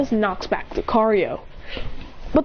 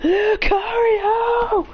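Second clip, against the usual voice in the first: high, slow, drawn-out creature call; Pokemon cry; 11 semitones higher, much slower than usual. Acted. Lucario crying out as it enters the battle, high and drawn out. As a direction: stretch the name out like a monster's cry.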